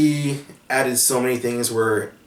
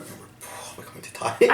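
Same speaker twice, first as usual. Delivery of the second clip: breathy half-whisper, pitched up; whispered dread; 11 semitones higher, 3 dB quieter than usual. Retold or imitated. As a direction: mutter it like a grim joke. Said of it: "It's just like".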